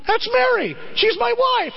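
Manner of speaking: very high, pleading cry; desperate George. Retold or imitated. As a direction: George tells the crowd she's his wife, frantic and high. George shouting to crowd.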